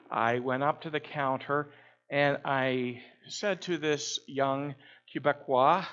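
ordinary talk, nothing marked

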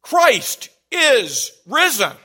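high, loud, slow proclamation; crying it out. Retold or imitated. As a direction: cry it out across the hall. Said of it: "the priest was crying it out".

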